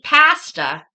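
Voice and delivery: pasta with a forceful, natural regional vowel; pasta said aggressively. Real, not acted. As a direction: punch the first syllable of pasta loudly. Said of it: "apparently I say that very weird".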